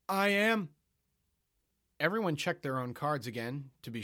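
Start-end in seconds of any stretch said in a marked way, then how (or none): 0.1-0.7 bold declaration. Acted.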